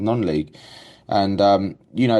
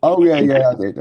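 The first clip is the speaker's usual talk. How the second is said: higher, louder hammed-up protest; mock defensive reply; 5 semitones higher, 6 dB louder than usual. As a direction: protest too much, playfully. Mimicking Pete's defensive reply.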